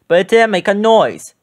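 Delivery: plain reading voice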